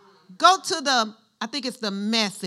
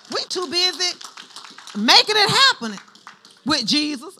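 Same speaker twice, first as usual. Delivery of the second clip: louder, higher emphatic declaration; emphatic preaching; 5 semitones higher, 7 dB louder than usual. Real, not acted. Preacher telling congregation what matters, loud emphatic. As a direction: declare it with conviction.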